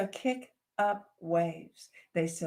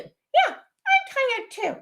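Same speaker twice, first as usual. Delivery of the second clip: much higher, slow little voice; another baby dragon; 7 dB louder, slower than usual. Acted. Sibling dragon agreeing, high small voice. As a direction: squeak it like a tiny dragon.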